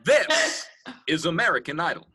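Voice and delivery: country singer twang. Retold or imitated.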